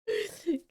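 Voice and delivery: a high burst of laughter; high startled laugh. Real, not acted. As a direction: laugh out in shock. Startled laugh at loud audio.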